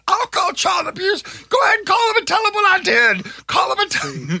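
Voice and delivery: high, shouted imitation of an enraged mom; mom screaming. Mom pinning her kid down, daring the call, screaming. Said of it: "she's the whole time screaming".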